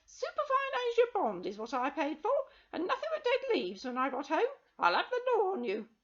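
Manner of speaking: high, piercing complaint; cheated woman shrieking at the witch; shrill woman. Acted. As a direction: make it a loud, high screech. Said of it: "her shrill protests".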